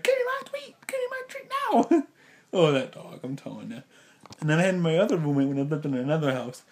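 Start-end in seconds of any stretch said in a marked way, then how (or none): 0.0-2.1 very high voicing of an eager dog; be the dog begging for a treat; squeaky pushy puppy voice. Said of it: "He's like".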